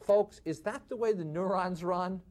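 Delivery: normal talking voice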